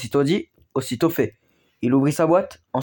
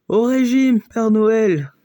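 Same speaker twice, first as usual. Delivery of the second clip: higher and louder, a teasing call; shouted in chorus; 7 semitones higher, 5 dB louder, much slower than usual. A narrator's reading. Toys all together telling Santa to diet, loud teasing shout. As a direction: tease him loudly together. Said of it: "dirent les jouets en chœur".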